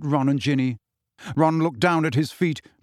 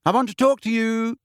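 put-on high young girl, coaxing; Hermione's voice; 10 semitones higher than usual. A narrator's reading. Hermione coaxing Harry to come out, high voice. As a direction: coax him to open the door, voice pitched high. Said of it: "said Hermione's voice".

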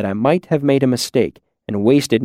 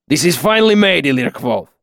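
put-on accented master craftsman, higher and firm; Kilvin, weighty master; 4 semitones higher than usual. Master appraising his student's lamp, weighty accented verdict. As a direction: pronounce judgment like a stern old-world master.

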